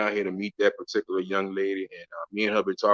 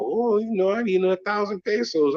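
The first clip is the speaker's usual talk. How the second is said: imitated woman haggling; 10 semitones higher than usual. Acts woman naming her price.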